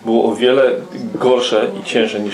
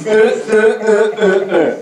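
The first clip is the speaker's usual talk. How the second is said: mocking high tut-tut sound; 9 semitones higher, slower than usual. Mocking the gossiping prayer request.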